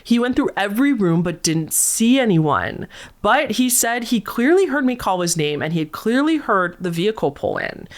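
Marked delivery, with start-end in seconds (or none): none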